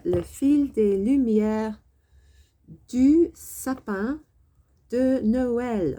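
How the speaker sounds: each word stretched, slow and loud; slow, loud teacher read; slow emphatic French. Shown as a demonstration. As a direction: spell out "le fil des lumières du sapin de Noël" slowly for learners. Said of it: "two very useful words here".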